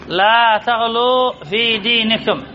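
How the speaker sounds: slow, measured reading with long vowels; stretched vowels in لا تغلوا في دينكم; slow dictation. Shown as a demonstration. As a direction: draw out each word for students to parse. Example repeated slowly for parsing.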